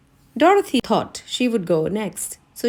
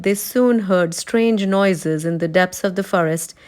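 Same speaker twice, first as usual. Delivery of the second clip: plain reading voice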